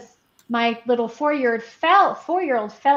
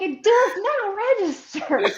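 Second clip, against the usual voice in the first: slow, high, laughing; laughing at the kids' oblivious excuse; laughing quote; 10 semitones higher, slower than usual. Retold or imitated. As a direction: kids are oblivious, she mocks them laughing. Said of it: "They're like".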